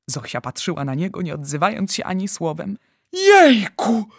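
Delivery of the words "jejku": high, loud exclamation; excited wonder. Acted. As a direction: burst out in amazement. Giant marvels at his catch.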